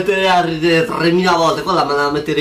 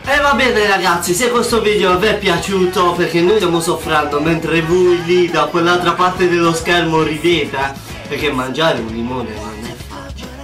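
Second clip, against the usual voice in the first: vlogger outro; 4 semitones higher than usual. Acted. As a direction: pitch it to the viewers like a channel outro. YouTuber's outro asking viewers for likes.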